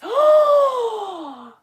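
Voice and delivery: high, loud gasp; delighted gasp. Real, not acted. Host recognising the viewers' answer, loud delighted gasp.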